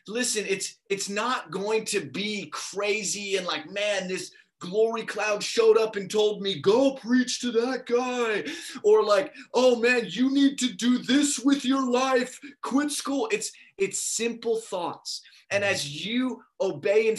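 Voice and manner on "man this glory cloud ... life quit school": higher, drawn-out mock revelation voice; hammed-up supernatural summons, stretched out slowly; mock dramatic command. Mocking imagined dramatic divine commands.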